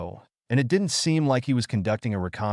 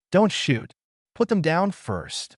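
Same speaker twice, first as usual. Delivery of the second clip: hoarse voice; 8 semitones higher, slower than usual. A narrator's reading. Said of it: "a mature and hoarse voice suddenly sounded".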